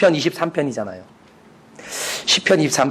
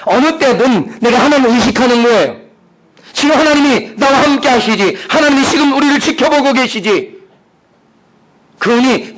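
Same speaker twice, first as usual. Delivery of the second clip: high, driving pulpit voice; forceful preaching; 9 semitones higher, 6 dB louder than usual. Real, not acted. Preacher telling the congregation God is watching, forceful. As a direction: proclaim it with rising conviction.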